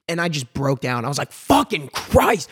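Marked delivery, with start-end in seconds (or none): 1.3-2.5 retold yell. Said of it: "I'm saying the yellingness in the lounge".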